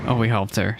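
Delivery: ordinary delivery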